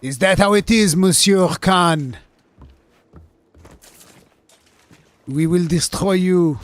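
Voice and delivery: loud, high, hammed-up French threat; mocking French accent. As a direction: play an outraged cartoon Frenchman. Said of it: "spoken English with a French accent".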